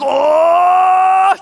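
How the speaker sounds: long, high, very loud "oh"; outraged wail, hammed up; mock offended yell. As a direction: imitate someone hugely affronted. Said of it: "so offended by that".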